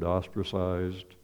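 ordinary delivery

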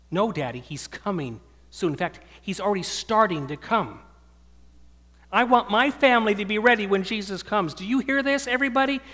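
put-on a small girl insisting at the dinner table; little daughter preaching. Father voicing his daughter urging the family at dinner, in a high urgent child voice. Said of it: "she stood up at the table and she said".

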